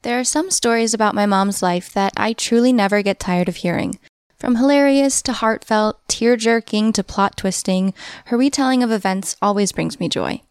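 ad read. Acted. Host reads a sponsor ad.

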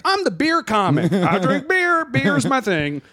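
lightly hammed beer comic; mock beer comic. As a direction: sell it like a hacky headliner.

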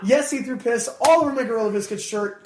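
ordinary talk, nothing marked

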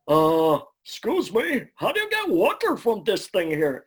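high, loud goofy cartoon voice; the dim, cheerful Ed asking about water. Actor at audition asks engineer in goofy high Ed voice. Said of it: "as Ed, I literally just went".

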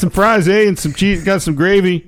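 mock Canadian accent. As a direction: play a cartoon Canadian cook, Canadian accent. Continues mock Canadian cook.

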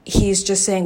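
usual speaking voice

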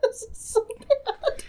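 high, laughing through the words. Real, not acted. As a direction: giggle it out in disbelief. Laughing at the movie.

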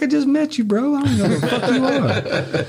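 mock squeaky protest at a stranger; incredulous retort. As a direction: pitch it up in comic disbelief. His own retorted reply, retold.

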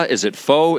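"fo" with a long O; pronunciation variant. Shown as a demonstration. Debating how to say the dish, "fo" with a long O. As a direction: say "fo" to rhyme with "go". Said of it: "nobody can agree how to pronounce it".

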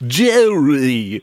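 a hint of Kramer calling Jerry; lightly mock Kramer yelling Jerry; Kramer-style Jerry. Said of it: "Is this Kramer?".

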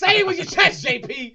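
high, booming holler; loud, high-pitched heckle; urging shout. Real, not acted. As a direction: belt it out to make a co-host speak up. Urging quiet co-host to speak up.

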